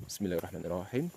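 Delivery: usual speaking voice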